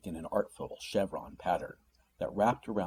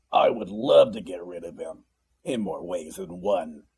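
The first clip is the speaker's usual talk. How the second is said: a hint of a snarl, louder; menacing demon relishing the idea; 5 dB louder than usual. A narrator's reading. Demon brother boasting about a nasty patient, with a light snarl. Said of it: "with a snarl".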